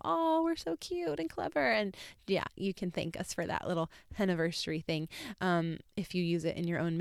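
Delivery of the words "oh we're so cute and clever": high, airy mock audience voice; lightly voiced fan cooing; mock fan gush. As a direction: gush like an admiring listener. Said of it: "You guys can all go".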